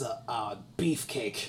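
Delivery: lightly hammed-up low voice; mock deep beefcake voice. Friends joking about Henry Cavill, mock deep voice. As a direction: drop low and slow for beefcake.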